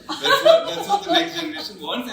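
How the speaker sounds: high, loud, through laughter; helpless laughter pushing the voice up high; laughing protest. Real, not acted. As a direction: squeal it out while laughing. Laughing through a cheeky explanation.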